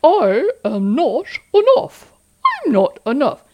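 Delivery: mock the thought in a silly cartoon voice; a goofy voice that laughs at the thought; funny cartoon voice. Shown as a demonstration. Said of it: "So try using a funny voice or an accent".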